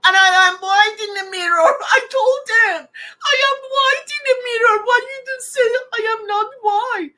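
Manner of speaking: a hurt, pleading shout; emotional outcry. Retold or imitated. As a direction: cry out against the unfairness, close to tears. Recalled protest about her color.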